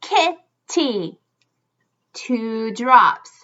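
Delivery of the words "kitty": Kitty broken in two, pitched higher; syllable by syllable. Shown as a demonstration. Teacher leading children to join in, split into syllables. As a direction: say Kitty as kit, pause, tee. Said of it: "Do it with me".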